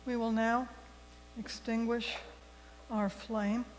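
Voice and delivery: ordinary talk, nothing marked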